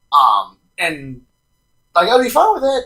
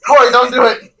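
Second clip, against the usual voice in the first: playful plea; 10 semitones higher, 7 dB louder than usual. Real, not acted. Pleading playfully.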